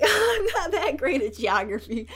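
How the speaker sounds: laughing through the words; giggly self-mocking admission. Real, not acted. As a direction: crack up at your own ignorance. Laughs at own ignorance.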